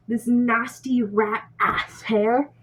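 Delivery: usual speaking voice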